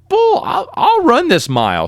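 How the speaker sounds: high defiant retort; mild retold anger flaring up; a touch of stung pride; mock indignant. Challenged, gets mad.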